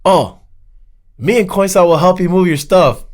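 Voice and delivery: mock the guy's breezy offer; imitating Ari. Ari offers help, quoted.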